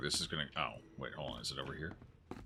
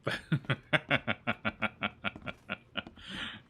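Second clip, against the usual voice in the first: long staccato chuckling run; long laugh; 2 semitones higher than usual. Real, not acted. Player reacting to an exploding monster, long staccato laugh. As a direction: laugh on in quick, even bursts.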